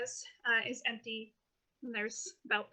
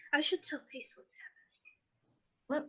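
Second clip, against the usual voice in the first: slow, hushed muttering to oneself; whispered aside; 6 dB quieter, slower than usual. Acted. Depp to himself about telling Pace, whispered aside. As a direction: whisper it as a thought aloud.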